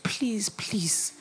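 soft unvoiced begging whisper; whispered please. Whispering a plea to the protocol officer. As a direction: beg under your breath.

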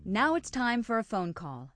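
ordinary talk

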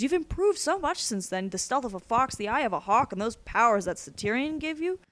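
villain lavishing praise, pitch lifted; admiring villain. Acted. Captor praising his protégé's powers, admiringly.

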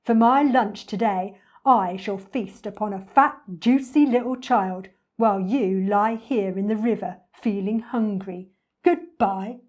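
loud, relishing storybook croc; grand, greedy crocodile announcement; boastful enormous crocodile, gloating. Acted. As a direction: gloat over a feast to a rival.

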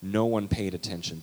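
ordinary talk, nothing marked

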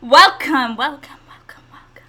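loud welcome fading away like an echo; echo effect. Pretend. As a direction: call it out and let it die off. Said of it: "It's like an echo".

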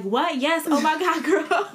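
high, loud excited yes; excited squeal. Retold or imitated. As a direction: gush it out, thrilled. Imitating friend's excited yes.

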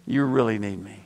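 low, measured voice of God; calm, low divine reminder; voice of the Lord. Retold or imitated. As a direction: drop the pitch and state it as God would. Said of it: "the Lord told me again".

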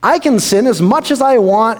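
sly "aha" discovery; gleeful mock scheming. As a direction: gloat over a loophole. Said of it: "looking at the statement and going".

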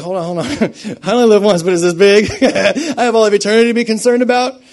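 loud, high, laughing comeback; laughing incredulous retort. Acted. Believer answering the scoffers, laughing and incredulous. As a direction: laugh out loud at how absurd it is. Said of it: "And you're like".

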